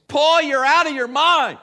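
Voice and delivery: loud, high outburst of accusation; high-pitched, booming interruption. Acted. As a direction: shout it out as a public accusation. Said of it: "Festus said in a loud voice".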